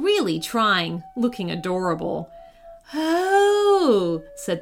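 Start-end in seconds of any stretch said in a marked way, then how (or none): 2.9-4.2 high, long cooing exclamation; charmed Queen. Acted. Queen seeing Willow look adorable, charmed. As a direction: melt into a drawn-out coo.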